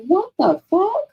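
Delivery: high and slow, rising on fuck; drawn-out disbelief. Retold or imitated. To her girlfriend over wild gossip, slow high disbelief. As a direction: stretch it out in mock outrage.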